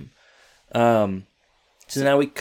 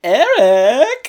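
high, loud answering shout "Eric!"; a hammed-up shouted reply; mock yell; 13 semitones higher, 10 dB louder than usual. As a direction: shout the name right back. Teacher yells back after pause.

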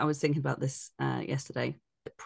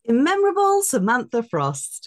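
announcer intro; 8 semitones higher, 7 dB louder than usual. Acted. Grand introduction.

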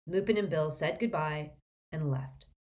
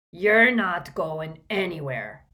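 slow, louder growl; growling Hagrid; 8 dB louder, slower than usual. A narrator's reading. Hagrid refusing to let Harry leave: slow growl. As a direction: lay down the law with a rumble. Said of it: "growled Hagrid".

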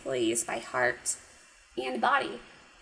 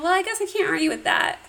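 mock resigned; 6 semitones higher, 3 dB louder, faster than usual. Hero gives in, quoted.

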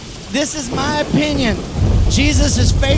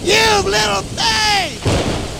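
slow, higher, gently amused; slow smiling Jesus line, fond rather than scolding; said with a smile; 7 semitones higher, much slower than usual. Retold or imitated. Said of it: "I believe he said it with a smile".

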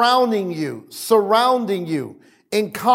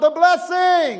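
high, ringing preacher's shout; congregational shout; 10 semitones higher than usual. Shown as a demonstration. Preacher leading the congregation in a response, high shout. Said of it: "somebody shout".

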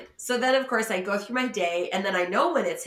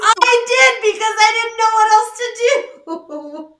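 much higher and louder, half laughing through it; laughing embarrassment; 12 semitones higher, 10 dB louder than usual. Real, not acted. Admitting to her co-host she did the interview, laughing. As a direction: giggle out the confession, mortified.

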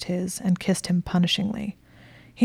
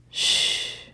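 purred hush. A narrator's reading. Woman hushing her lover in his ear, purring. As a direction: gently hush someone close to the ear. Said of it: "she purred in his ear".

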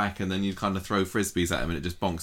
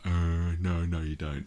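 gormless denial; slower than usual. Retold or imitated. Podcast host imitating gormless Craig denying, mild. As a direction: play dumb, lightly voiced.

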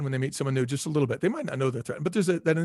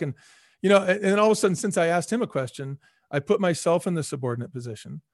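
ordinary talk, nothing marked

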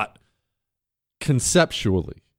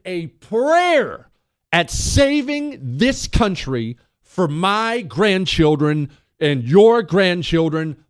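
rising rant; 6 semitones higher than usual. Real, not acted. Rallying rant about huge changes.

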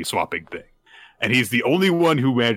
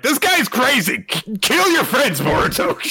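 much higher, louder hammed-up villain cry; shrill villain shriek; 13 semitones higher, 3 dB louder than usual. Momoshiki screaming at Boruto, a hammed-up high shriek. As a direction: screech it like a cartoon villain losing control. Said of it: "Momoshiki's like".

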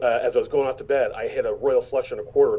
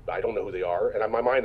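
ordinary delivery

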